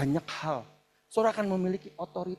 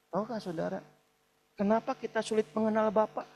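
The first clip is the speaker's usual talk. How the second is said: usual speaking voice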